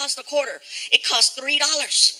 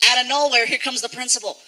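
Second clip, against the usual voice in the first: ordinary delivery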